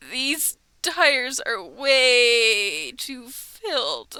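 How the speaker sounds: loud, drawn-out imitation of a mechanic; mock mechanic's verdict. Oil change worker reporting on the tires, slow mock verdict. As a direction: stretch it out slowly and loudly, mock-grave. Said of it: "and they're like".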